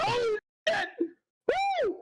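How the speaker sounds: sudden high squeal of shock; shocked yelp. Retold or imitated. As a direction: yelp it out in total surprise. Shocked by the giant villain appearing.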